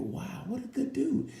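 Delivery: high, slow, wide-eyed wow; mock amazement. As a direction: gush like you just saw a saint. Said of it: "You go".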